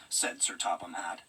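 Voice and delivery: usual speaking voice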